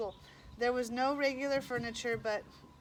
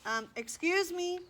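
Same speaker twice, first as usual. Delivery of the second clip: higher-pitched call for attention; 7 semitones higher, 3 dB louder than usual. A narrator's reading. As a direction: lightly call over to someone. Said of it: "over, calling".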